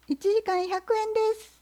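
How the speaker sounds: fox. Acted. Fox voiced.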